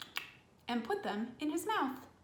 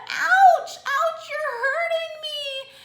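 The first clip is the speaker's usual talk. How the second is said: much higher, louder yelps of pain; ouch cry; 12 dB louder, slower than usual. Acted. Elephant child yelps as the crocodile bites his nose. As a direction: squeal out in hammed-up pain.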